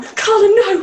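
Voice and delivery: high, loud mock yell; imitated panicked mother, shouting; mum's alarmed cry. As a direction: cry out to stop your daughter. Frightened mum grabs her arm.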